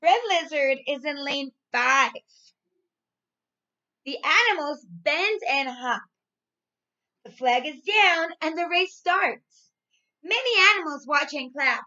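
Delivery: put-on loud, lively storybook reading for young kids; reading to children. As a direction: read it out big and bright, like to a class of little ones. Teacher reads storybook to class.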